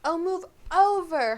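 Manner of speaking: loud, high, slow snarl; exasperated, drawn-out order. A narrator's reading. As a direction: shove him aside with your voice. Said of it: "Hermione snarled".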